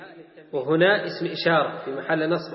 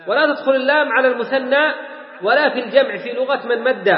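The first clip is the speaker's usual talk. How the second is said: raised, louder lesson-book recitation; reading text aloud; 6 semitones higher, 5 dB louder than usual. Acted. Teacher reading the grammar rule to students, recitation. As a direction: read the grammar text out, pitched up.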